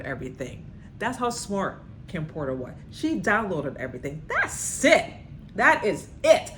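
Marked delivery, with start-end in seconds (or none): 4.3-6.5 high and loud emphatic agreement; excited exclaim. Real, not acted. Host agreeing with the diary, exclaiming loud and high.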